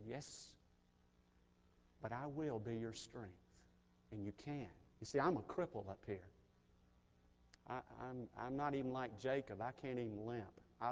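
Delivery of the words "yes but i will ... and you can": soft, low, tender reassurance; God comforting. Retold or imitated. God answering the grieving father, soft and tender. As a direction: speak it gently, barely above a hush. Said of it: "But he said".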